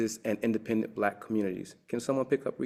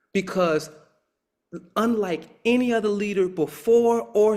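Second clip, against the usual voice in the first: excited lecturing; 10 semitones higher, 7 dB louder than usual. Real, not acted. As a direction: build it up like a revelation, laughing once. Lecturer climaxes about the Wizard.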